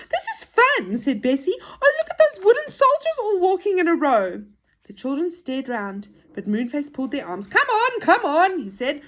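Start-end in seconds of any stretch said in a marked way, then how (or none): 0.1-4.4 put-on high, loud and delighted child's voice; excited child. Bessie marvels at wooden soldiers walking in a row, excited. 7.5-8.6 high, loud hurry-up call; urging. Acted. Moonface pulls the children along, urging. Character hurries friends along.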